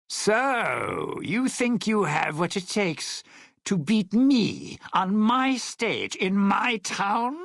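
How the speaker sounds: high-pitched, incredulous challenge to a rival; indignant challenge. Acted. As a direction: throw down a territorial challenge. Challenges a rival on his stage.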